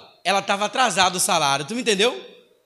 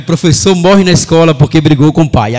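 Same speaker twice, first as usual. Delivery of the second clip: loud, mock headline announcement; jokey reporter's proclamation; mock news headline; 2 semitones lower, 13 dB louder than usual. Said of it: "a reportagem ia ser essa".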